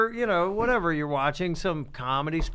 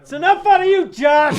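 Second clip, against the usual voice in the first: loud, higher shout; mock telling-off; 9 semitones higher, 6 dB louder than usual. Host shutting up Josh for chiming in, mock-angry shout. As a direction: shout him down in mock annoyance.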